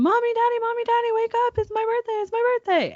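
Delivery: put-on very high, giddy child voice; imitating daughter running in to wake them; excited toddler. Daughter waking her parents on her birthday, very high. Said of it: "she came running into our room".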